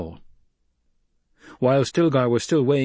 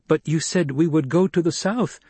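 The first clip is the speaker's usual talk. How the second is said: higher, mildly protesting voice; 7 semitones higher, 3 dB louder than usual. A narrator's reading. Chani objecting to Paul's order, protesting. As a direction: object to the change of plan. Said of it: "she protested".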